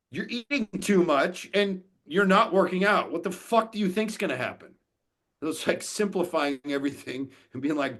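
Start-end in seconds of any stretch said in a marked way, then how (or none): 0.1-4.7 higher, louder exasperated retort to a client; half-playful yell of disbelief at the excuses; retold blunt callout. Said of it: "I'm like".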